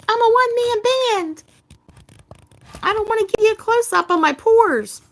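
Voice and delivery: high, loud squeaky put-on voice; Calamity impression. Host quoting friend Calamity in a high loud mock voice. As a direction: pitch it way up and belt it like Calamity. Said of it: "As Calamity would say".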